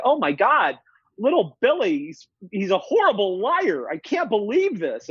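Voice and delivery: hammed-up alarm over a lying child; distraught parent. Worried parent complains to the theorist, mock horror.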